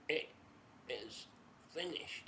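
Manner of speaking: very soft, halting dying words; hushed last words. Acted. Jesus dying on the cross, hushed and halting. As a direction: say it faintly, word by word.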